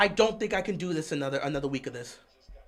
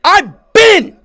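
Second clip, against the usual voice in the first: loud, raised-pitch fan rant; ranting yell; 6 semitones higher, 13 dB louder than usual. Real, not acted. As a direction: yell your take in exasperation. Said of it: "I'm not screaming at you".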